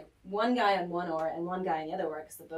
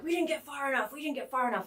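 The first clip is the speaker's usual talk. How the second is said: higher, insistent mock cry; imitated urgency; 7 semitones higher, faster than usual. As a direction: imitate excited friends insisting. Imitates the guys after first circle.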